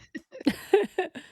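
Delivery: high burst of real laughter; bright amused laugh. Real, not acted. Laughing at her own joke.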